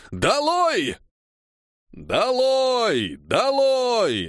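very high, long-held chant shout; roaring crowd. A narrator's reading. Crowd of strikers against the Cossacks, roaring. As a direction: stretch the word into a mob's roar. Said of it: "оглушительно взревела толпа".

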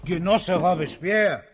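loud alarmed call; a startled shout across the room. Acted. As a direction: call his name to rouse him. Calling out to a fallen man.

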